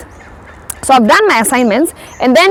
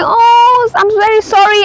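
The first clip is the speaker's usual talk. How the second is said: sudden apology; 9 semitones higher than usual. Retold or imitated. Apologising after learning the rule.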